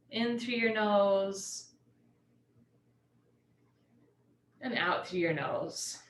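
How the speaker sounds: slow, drawn-out yoga teacher cues; unhurried relaxation guide, letting phrase endings stretch; guided meditation. Real, not acted. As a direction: ease them into stillness with long pauses. Leads class into meditation.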